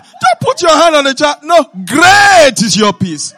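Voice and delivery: slow, loud declaration; preaching shout. Acted. Preacher declares peace over sleepless children, shouting. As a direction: boom out the promise of peace.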